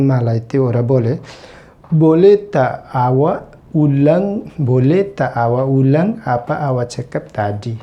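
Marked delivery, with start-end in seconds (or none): 1.9-4.4 very slow, a bit higher and louder; emphatic slow demonstration for learners; slow teaching demo. Shown as a demonstration. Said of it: "teacher demonstrating Malay phrase". 4.7-7.7 slow demo. Shown as a demonstration. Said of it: "teacher repeats Malay phrase".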